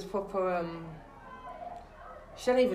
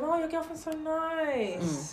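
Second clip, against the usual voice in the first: fake sweet; 8 semitones higher, 3 dB quieter, slower than usual. Pointed remark to the cheating guy.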